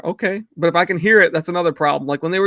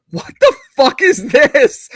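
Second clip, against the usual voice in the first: high, slow, loud mock disbelief; imitating a stunned partner looking up from her phone; wife's shocked reaction; 10 semitones higher, 8 dB louder, slower than usual. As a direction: play her stunned what the fuck. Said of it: "and she was like".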